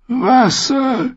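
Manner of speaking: high, gasping croak; a high parched gasp for water. A narrator's reading. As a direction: gasp the word out with no breath. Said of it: "keuchte der mit den Fliegen im Bart".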